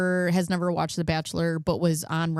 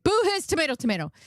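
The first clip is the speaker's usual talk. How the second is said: high booing shout; 8 semitones higher, faster than usual. Acted. Booing the villain again.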